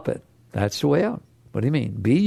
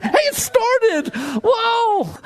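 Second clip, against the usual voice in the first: much higher, slow, wide-eyed joy; thrilled surprise; much slower than usual. Acted. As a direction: marvel as if it's a miracle. Thrilled that the car started.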